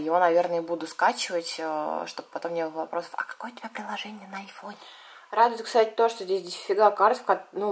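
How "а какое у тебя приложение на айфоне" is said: whispery, much softer quoted question; hushed mock questioner; imitated question. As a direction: mimic a nosy question in a whisper. Voices viewers' expected question.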